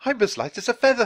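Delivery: high, fast, giddy cry; joyful shout. A narrator's reading. Actor playing Scrooge hops about on stage, joyful shout. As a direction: shout it with wild joy. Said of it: "he shouted".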